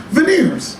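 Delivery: high, laughing squeak on the word; squeaky punchline. Real, not acted. Punchline to audience, squeaked with a laugh.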